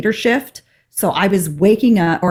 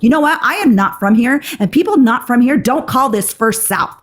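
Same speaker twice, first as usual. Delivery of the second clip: higher-pitched indignant telling-off; retold angry rant, pitched up; laying into someone; 5 semitones higher than usual. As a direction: act out scolding the receptionist. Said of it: "because I just laid into her".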